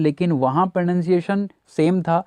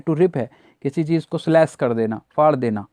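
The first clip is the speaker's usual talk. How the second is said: usual speaking voice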